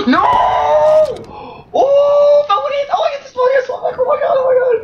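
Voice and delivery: very high desperate yelling; frantic shriek. Real, not acted. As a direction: scream at the screen. Frantic gameplay moment.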